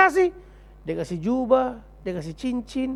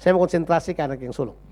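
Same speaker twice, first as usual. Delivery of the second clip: ordinary talk, nothing marked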